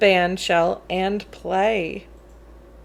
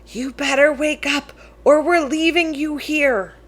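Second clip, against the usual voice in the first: higher voice, nudging her brother awake; sister in my ear; 6 semitones higher than usual. Acted. Sister in his ear at the concert, higher voice. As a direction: lift the pitch and play the big sister. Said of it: "spoke in my ear".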